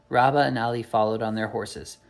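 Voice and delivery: ordinary delivery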